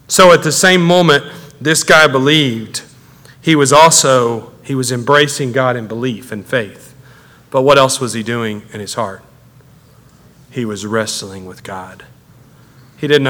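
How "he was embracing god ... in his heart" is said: normal talking voice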